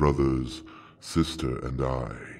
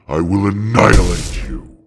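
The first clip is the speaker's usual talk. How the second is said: loud, high roar of a threat; enraged dragon declaring annihilation; furious dragon threat; 6 semitones higher, 10 dB louder than usual. Acted. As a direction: bellow it to crush the trickster. Dragon threatens to destroy them.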